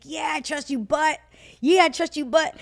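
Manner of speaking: agree, then balk on the but; retold whiny hedging; reluctant protest. Retold hesitant answer to God.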